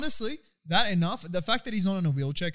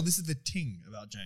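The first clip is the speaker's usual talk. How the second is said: Irish "ting" with a hard T, lower and softer; mock Irish accent; 7 semitones lower, 7 dB quieter than usual. Shown as a demonstration. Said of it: "Or if you will".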